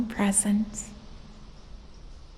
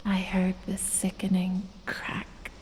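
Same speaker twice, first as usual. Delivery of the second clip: normal talking voice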